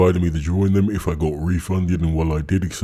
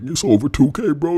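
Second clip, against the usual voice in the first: much higher, whiny mock voice; mocking whine; 8 semitones higher than usual. Mocking the guy begging not to lose the money, high whine. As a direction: whine it like a pathetic beggar. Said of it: "But then he's like".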